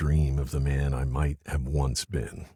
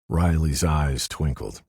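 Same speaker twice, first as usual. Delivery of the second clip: plain reading voice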